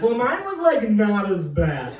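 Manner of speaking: downplay it sheepishly; mock excuse. Inmate defending his own case to others. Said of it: "You're like".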